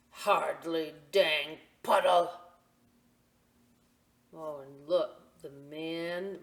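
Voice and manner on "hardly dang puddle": put-on slow, loud, grumbling old man; grumpy old man. Grumpy old man complaining about rain, slow grumble.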